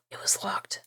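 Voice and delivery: breathy whispered aside after a gasp. Real, not acted. Streamer whispering as she reads a game note. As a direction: drop to a whisper at the find.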